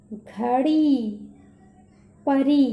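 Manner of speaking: हाथी, मछली, घड़ी, परी with the final ई held long; drawn-out long ई. Shown as a demonstration. Teacher shows children how to stretch the long ई vowel. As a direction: stretch each long ई slowly and loudly. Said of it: "आराम से बोलना है और थोड़ा सा खींच कर बोलना है".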